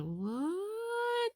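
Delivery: high, soft hurt surprise; taken aback and stung; startled. Retold or imitated. Emerald stunned after Cinder snaps, high and soft.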